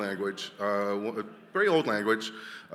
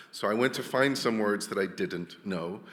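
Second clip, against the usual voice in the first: normal talking voice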